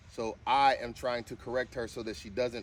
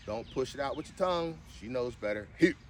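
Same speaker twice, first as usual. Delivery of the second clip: ordinary talk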